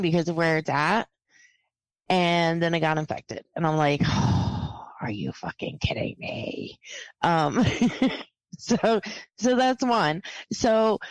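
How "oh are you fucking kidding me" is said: low, breath-heavy disbelief; hushed exasperation. Retold or imitated. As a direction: sigh it out low and under the breath. Retells exasperation at infected bite.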